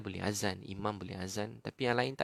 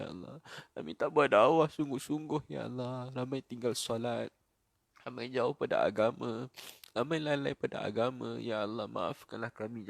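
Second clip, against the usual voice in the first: loud bursts of pleading, then falling away; impassioned repentant prayer; 3 dB louder than usual. Real, not acted. Preacher confesses the community's neglect to God, impassioned pleading. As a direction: cry out each confession to God.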